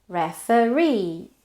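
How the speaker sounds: slow "referee", weight on the final -ee; stress on last syllable. Shown as a demonstration. Teacher showing suffix stress to learners, slow "referee" stressed at the end. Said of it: "has the stress on the suffix".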